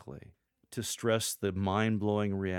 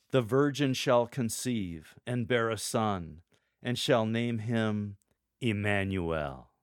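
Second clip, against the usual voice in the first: slow, louder, full of power; bold and authoritative; 5 dB louder, slower than usual. Shown as a demonstration. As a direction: proclaim it with authority. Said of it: "a tone of voice evoking power and authority".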